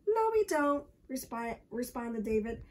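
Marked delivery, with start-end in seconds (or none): 0.1-0.9 put-on high, loud child's contradiction; defiant retort. A narrator's reading. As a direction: fire back cheekily. David talks back to Michael.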